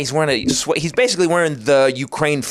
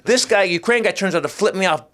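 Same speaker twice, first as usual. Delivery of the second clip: ordinary talk, nothing marked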